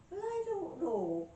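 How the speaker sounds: high, drawn-out Irish doubt; Irish accent. Acted. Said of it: "I got me an Irish accent".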